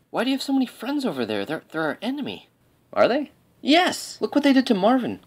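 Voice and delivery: high, incredulous squawk; shrill with disbelief at a friend's betrayal; outraged disbelief. Acted. Outraged soldier questioning friend.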